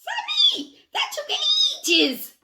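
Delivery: high, loud mock exasperation; playful exclamation. Teasing Sammy Sloth after his climb, high playful squeal. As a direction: squeal it in pretend disbelief.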